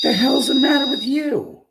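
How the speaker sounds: hammed-up indignant shout; mock outburst.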